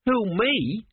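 high-pitched startled lad; young apprentice. A narrator's reading. Young apprentice singled out by a mummy, high startled voice. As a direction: squeak it up high like a nervous apprentice. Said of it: "said Gurn".

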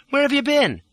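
high-pitched woman's sharp question; demanding. A narrator's reading. Lola questioning the fugitive in her coach, demanding. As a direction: demand an explanation. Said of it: "she demanded".

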